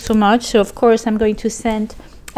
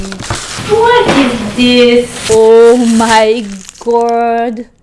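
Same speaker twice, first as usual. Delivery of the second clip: drawn-out, much louder amazement; astonished; 9 dB louder, much slower than usual. Real, not acted. Finding chocolate in a viewer's package, slow loud amazement. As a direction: stretch it out in disbelief. Unwrapping surprise gift.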